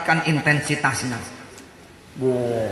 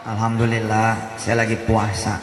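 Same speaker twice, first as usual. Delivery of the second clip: calm pious refusal; 7 semitones lower than usual. Retold or imitated.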